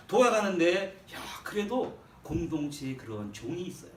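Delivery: usual speaking voice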